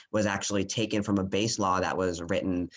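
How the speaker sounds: ordinary delivery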